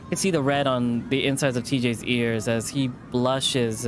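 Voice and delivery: plain reading voice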